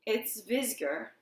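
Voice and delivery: slow, thickly spoken words; slow, muffled dread. A narrator's reading. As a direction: speak as through a stifling veil. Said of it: "he spoke thickly".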